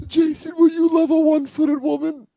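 high-pitched melodramatic plea; hammed-up tragic heroine, high voice; dramatic wife imitation. Friend mimics wife pleading with her husband, high melodrama. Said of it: "hearing her drama it up on the phone".

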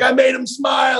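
high, shouted triumph; going nuts. Retold or imitated. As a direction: crow it like a giddy kid. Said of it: "I'm going nuts".

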